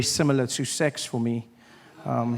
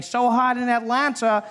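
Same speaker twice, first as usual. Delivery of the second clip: news reporter; 6 semitones higher, 3 dB louder than usual. Retold or imitated. Local news crew reporting his fainting in mock reporter voice. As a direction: ham up a TV reporter on location. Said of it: "They'd be standing there going".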